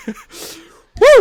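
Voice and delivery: high-pitched whoop; high woo. Real, not acted. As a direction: yelp at the heat. Reacting to heat.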